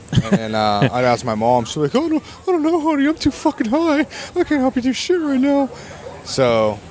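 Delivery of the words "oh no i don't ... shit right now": high-pitched, spacey imitation of a stoned mom. Mother brushing off her kid's homework, high put-on voice. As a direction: squeak it out like a mom too high to help.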